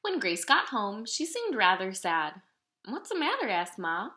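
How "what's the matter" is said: concerned question. Acted. Ma asks sad Grace.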